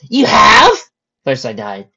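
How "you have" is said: high, loud surprised question. Acted. Friend astonished that she knows the way, loud surprise. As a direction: blurt it out in astonishment.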